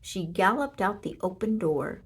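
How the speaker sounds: ordinary delivery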